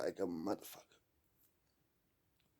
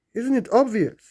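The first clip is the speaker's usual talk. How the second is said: emphatic; 8 semitones higher, 10 dB louder than usual. Acted. Rhetorical question, then repeated.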